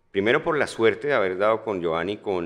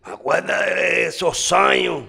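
put-on loud, slow, high Cuban-accent shout; 11 semitones higher, 8 dB louder, slower than usual. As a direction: ham up a Cuban accent and holler it out. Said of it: "Ah, no, tú lo haces así".

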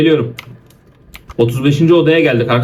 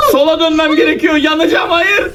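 being chased; 11 semitones higher than usual. Real, not acted. Yaratıktan kaçarken panik.

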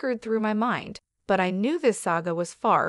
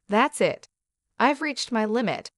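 ordinary delivery, nothing marked

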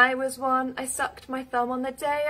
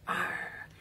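pirate growl; 9 dB quieter than usual. Pretend. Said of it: "because we're pirates today".

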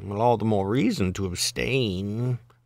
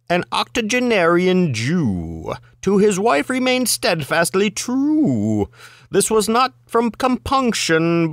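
deliberate higher-pitched recitation with stretched rhymes; measured recitation; 8 semitones higher than usual. Acted. Reader's first go at the limerick, measured recitation. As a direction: draw out "true" and linger on "you".

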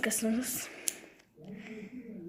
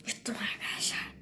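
whispered; 3 semitones lower, 8 dB quieter than usual. Real, not acted. Just swallowed sour lemon.

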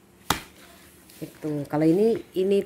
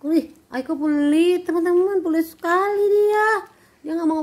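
teasing; 9 semitones higher, 4 dB louder than usual. Acted. Teasing child for not sharing.

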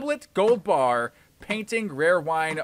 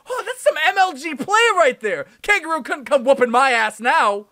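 high, loud excited celebration; triumphant yelling; 12 semitones higher, 7 dB louder than usual. Real, not acted. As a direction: crow over your big win. Finds last item and escapes.

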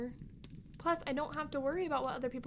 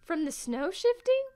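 higher and louder, still alarmed; shaken question; 5 semitones higher, 9 dB louder than usual. Acted. Sibling asks about the crash, shaken loud question. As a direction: ask nervously.

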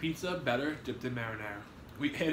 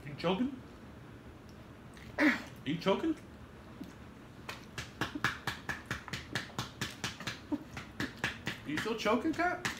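rising, worried questions to a choking friend; alarmed questions; 7 semitones higher, 5 dB quieter, slower than usual. Real, not acted. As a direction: check on her, getting more scared.

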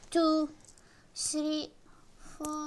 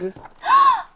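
loud, high theatrical gasp; mock gasp; 12 dB louder than usual. Teacher gasping over the picture of the king in mock shock. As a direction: gasp in exaggerated surprise.

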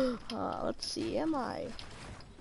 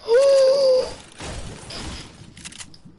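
loud gasp; 13 semitones higher, 5 dB louder than usual. Real, not acted. Big gasp.

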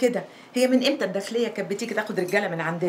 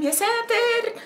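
alarmed cry; 13 semitones higher, much slower than usual. Acted. Frightened gasp at the siren.

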